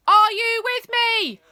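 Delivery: loud, high, slow rally call; rally the troops; rallying shout. Acted. Rebel rallying.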